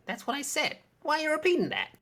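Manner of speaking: high, incredulous complaint; exasperated. Real, not acted. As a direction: act baffled and put out.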